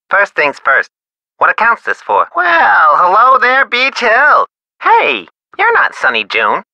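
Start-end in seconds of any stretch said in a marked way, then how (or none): 2.3-4.5 high, drawn-out hello; effusive greeting. Acted. Supplier greeting Beech Hill caller, effusive. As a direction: greet the caller in a big, high-pitched welcome.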